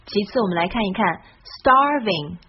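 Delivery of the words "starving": "starving" spoken carefully and a bit louder; clear starving. Shown as a demonstration. Teacher shows the word starving clearly. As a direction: say "starving" cleanly, star first. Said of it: "它的发音".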